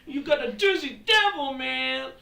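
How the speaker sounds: very high, loud hammed-up Jersey accent; mock Jersey accent. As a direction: yell it in an exaggerated fake Jersey accent. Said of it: "true Jersey accent is".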